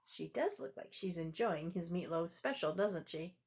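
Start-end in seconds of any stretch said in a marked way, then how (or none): none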